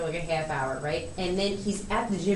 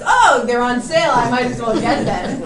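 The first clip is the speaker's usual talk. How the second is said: loud, high burst of feigned discovery; mock delighted surprise; 3 semitones higher, 9 dB louder than usual. Daughter imitating Dad told of the regular price, mock delight. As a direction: exclaim like Dad finding a bargain. Acts Dad's delight at the sale.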